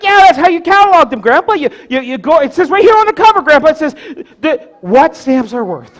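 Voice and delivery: put-on high, quick, insistent kid; arguing grandson. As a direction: protest to grandpa in a rush. Boy argues back with grandpa.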